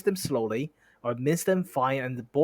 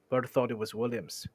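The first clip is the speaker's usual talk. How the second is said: usual speaking voice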